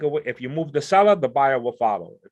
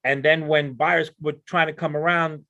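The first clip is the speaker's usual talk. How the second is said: ordinary delivery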